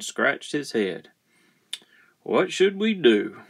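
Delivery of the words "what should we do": higher-pitched puzzled question; lightly acted question, pitched up; character asking. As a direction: ask it in a raised character voice. Character asks the family.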